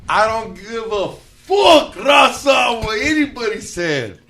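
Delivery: loud, drawn-out mock shout; imitating the comedian. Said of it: "The last time I seen you, you were like".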